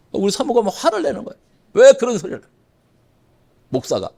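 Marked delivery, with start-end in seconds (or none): none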